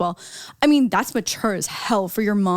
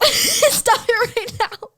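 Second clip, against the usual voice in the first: high, slow, crying laugh; laughing through tears; 14 semitones higher, much slower than usual. Real, not acted. Co-host begging the host to stop, laughing tearfully. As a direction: plead through a teary laugh.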